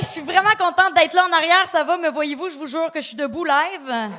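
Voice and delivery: ordinary delivery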